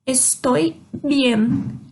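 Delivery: Estoy bien drawn out slowly; very slow model. Shown as a demonstration. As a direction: stretch out Estoy bien for learners. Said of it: "eu respondo e eu devolvo a pergunta". (bem devagar, para os alunos ouvirem)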